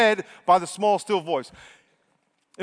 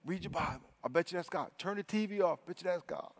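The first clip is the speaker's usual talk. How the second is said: very soft asides; hushed, as if thinking to yourself; little inner voice; 12 dB quieter than usual. Acted. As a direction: drop far down in volume. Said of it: "you hear this little voice say".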